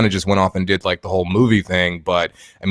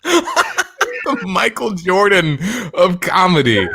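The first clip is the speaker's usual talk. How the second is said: slow, high repeat through laughter; drawn-out laughing mockery; incredulous laughter; 8 semitones higher, much slower than usual. Real, not acted. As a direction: savor the absurd boast while laughing. Mocking the clip's boast.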